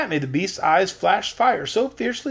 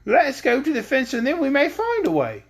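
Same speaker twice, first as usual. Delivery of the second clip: higher put-on girl voice; light weird English girl voice; Scraps voice; 9 semitones higher than usual. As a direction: lift the voice up for the patchwork girl. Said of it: "It's the weird English one".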